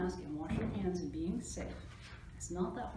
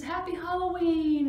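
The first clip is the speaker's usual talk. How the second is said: high, loud, drawn-out greeting; excited cheer; 10 semitones higher, 10 dB louder than usual. A narrator's reading. Reader ending the Halloween story for children, cheering. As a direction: cheer it out slowly.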